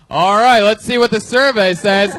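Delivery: loud game show host throwing to the board; Steve Harvey. Retold or imitated. Comic retells the host turning to the board, loud host impression. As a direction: announce it like an exasperated TV host. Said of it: "And then he just goes".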